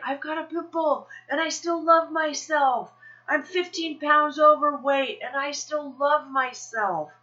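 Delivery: high, drawn-out, loud self-affirmation; bold public declaration. Acted. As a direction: proclaim it proudly to a busy mall. Rehearsing lines to shout in mall.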